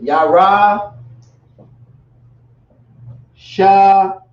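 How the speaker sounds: teacher sounding out "Yara Shalom" for the class; Yara Shalom pronounced. Shown as a demonstration. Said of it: "how you would actually say it in the Lashawan".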